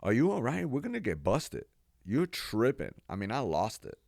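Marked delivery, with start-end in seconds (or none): 0.0-2.9 put-on high, loud, urgent girl; girl's alarmed voice. The girl fearing they'll get busted, high and loud. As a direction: snap him out of it, worried. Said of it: "turns to normal voice".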